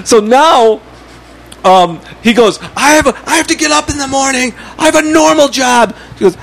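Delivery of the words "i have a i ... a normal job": high, put-upon mock protest; retold indignant complaint. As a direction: imitate an aggrieved neighbor pleading his case. Acts out neighbor's indignant complaint.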